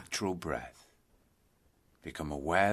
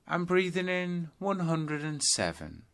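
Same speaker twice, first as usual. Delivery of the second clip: in-breath count, pitched higher; 7 semitones higher than usual. Shown as a demonstration. Teacher demonstrating the in-breath count, pitched higher. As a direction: lift the voice with the in-breath. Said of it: "start counting your breaths backwards".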